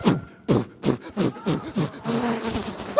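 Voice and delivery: repeated low puffs of flapping noise. Retold or imitated. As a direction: imitate a strap beating in the wind. Imitates strap flapping in wind.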